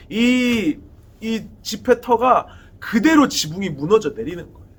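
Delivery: ordinary delivery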